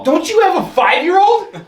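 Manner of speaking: high, loud disbelief; can't believe what you hear; incredulous. Real, not acted. Incredulous call out.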